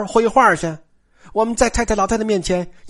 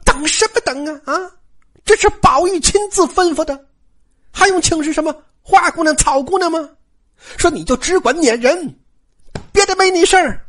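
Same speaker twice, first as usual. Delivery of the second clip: high-pitched, indignant outburst; furious; 8 semitones higher than usual. Acted. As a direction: cut her off in an angry, raised pitch. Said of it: "晴雯大怒说". (大怒，高声斥责)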